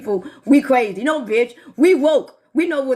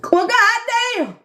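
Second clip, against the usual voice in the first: surprised; 8 semitones higher, much slower than usual. Real, not acted. Startled by noise overhead.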